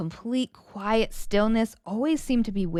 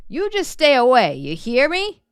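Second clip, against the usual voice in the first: stern warning; 4 semitones higher, 6 dB louder than usual. Acted. Pa warns daughter off egg.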